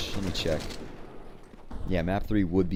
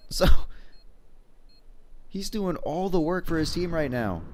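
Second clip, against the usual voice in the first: higher and hyped, building to a peak; excited play-by-play; 6 semitones higher than usual. Acted. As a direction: hype up the clutch plays. Play-by-play of a clutch round.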